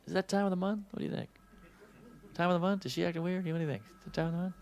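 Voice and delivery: hushed, tentative questions; furtive guessing. Acted. Clueless men guess about a woman's period, furtively. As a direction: ask very softly, as if afraid to be overheard.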